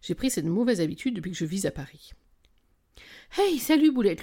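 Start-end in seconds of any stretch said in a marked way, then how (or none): 3.4-4.2 high, loud phone hello; breathless greeting. A narrator's reading. Narrator greets best friend on picking up the phone, breathless. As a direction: hail a friend as you pick up. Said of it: "lui lançai-je en décrochant essoufflé".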